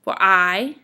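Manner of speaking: light "for", then a loud, long "I"; demonstrating an iamb, heavy weight on "I"; iamb, stress on I. Shown as a demonstration. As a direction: punch "I" after a short "for". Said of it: "that's three iambs".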